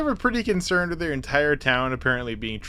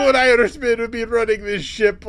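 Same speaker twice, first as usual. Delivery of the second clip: put-on high, loud, wobbly Irish accent; hammed-up stage Irish, pitched up; badly faked Irish villager; mock Irish; 9 semitones higher, 5 dB louder than usual. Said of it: "I don't know what accent I'm doing".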